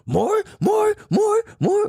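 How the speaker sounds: put-on very high, shouted little-kid demand; toddler demanding. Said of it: "he was like".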